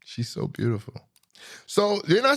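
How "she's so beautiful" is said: soft, breathy lovesick sigh; heartbroken sigh. Acted. Missing Jessica Alba on screen, soft lovesick sigh. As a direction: breathe it out like your heart's broken. Heartbroken after she's gone.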